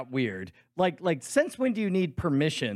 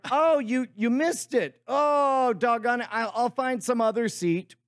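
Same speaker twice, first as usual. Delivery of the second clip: higher mock disappointment; put-on phone chat, pitched up; fake phone call; 6 semitones higher, slower than usual. Pretend caller excusing a seat change, play-acted dismay.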